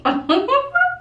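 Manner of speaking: squealing high laugh. Real, not acted. Laugh after whispered aside.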